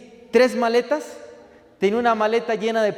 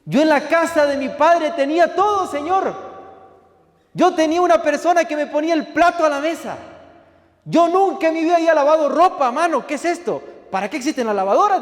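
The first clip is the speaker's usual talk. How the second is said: high, strained, tearful protest to God; retold crying complaint; 7 semitones higher, 3 dB louder than usual. Young cook complaining to the Lord, tearful and higher. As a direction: pour out a half-sobbing lament. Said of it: "entré a mi cuarto llorando".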